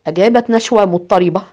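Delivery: plain reading voice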